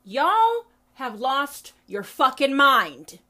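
loud and slow, each word dragged out; exasperated call-out. Retold or imitated. TikTok Christians telling other believers off, loud and slow. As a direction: slam it down slowly and loud, disbelieving. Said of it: "Christians on TikTok to this day who tell us".

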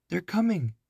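high urgent cry; girl crying out in alarm. A narrator's reading. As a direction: warn him the guards are near. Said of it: "Annie cried".

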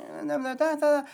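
high, wordless babbling imitation of a confused grandma. Retelling a visit, mimicking his girlfriend's grandma's high babble. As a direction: babble it like she can't find words. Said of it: "she's like".

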